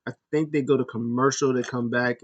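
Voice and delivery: ordinary talk, nothing marked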